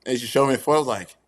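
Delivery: ordinary talk, nothing marked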